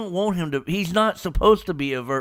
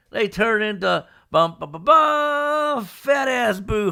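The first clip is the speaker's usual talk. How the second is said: mock fusion sound; 8 semitones higher, 4 dB louder, slower than usual. Fusion sound effect, shouted.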